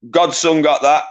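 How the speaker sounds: normal talking voice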